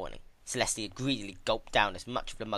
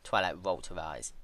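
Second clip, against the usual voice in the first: plain reading voice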